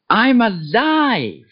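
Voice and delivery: ordinary talk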